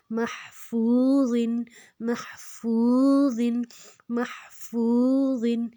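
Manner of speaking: slow محفوظن, clear h and drawn-out "foo"; long vowel. Shown as a demonstration. Teacher drills the two-beat stretch, long "foo" in محفوظن. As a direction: sound the h, then hold "foo" two beats in محفوظن. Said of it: "اس کو دو حرکت لمبا کریں گے".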